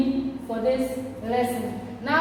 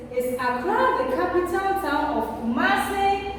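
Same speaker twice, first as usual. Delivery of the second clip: "Is Accra the capital town of Kumasi?" asked on a higher pitch; clearly modelled question, "Accra" raised high; question intonation; 5 semitones higher than usual. Shown as a demonstration. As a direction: lift "Accra" and keep the question up. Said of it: "So my question is".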